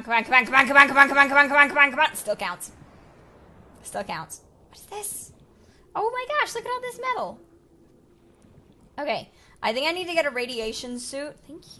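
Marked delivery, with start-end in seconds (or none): 0.0-2.1 desperate yells, building to a shout; frantic shouting. Real, not acted. Racing to reach air. 4.7-5.3 whispered. Real, not acted. Whispered curiosity at find. 6.0-7.4 high and loud, thrilled at the find; delighted high squeal; excited squeal. Real, not acted. Delighted discovery.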